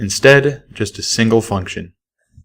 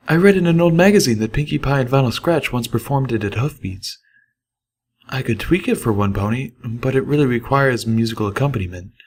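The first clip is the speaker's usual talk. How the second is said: higher, thoughtful singer flipping her list; musing Velvet Remedy; 5 semitones higher than usual. Acted. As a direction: think aloud in a lifted diva voice. Narrator voices Velvet Remedy.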